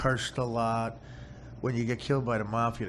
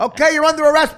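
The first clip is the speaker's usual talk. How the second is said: higher mock-cop arrest; cop talk; 14 dB louder, faster than usual. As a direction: announce the arrest like a movie cop. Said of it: "They talk like cops".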